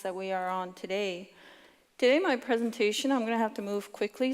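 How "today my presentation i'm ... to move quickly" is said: rushed, fast auctioneer-style delivery; fast talking. Real, not acted. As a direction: rattle it off quickly. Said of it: "if I sound like an auctioneer".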